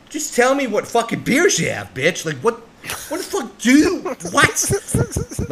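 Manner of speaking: rising into a high, loud mock yell; hammed-up frustration at the waitress; building outrage, ending in a shrill what; mock exasperated rant. Retold demand at the bar.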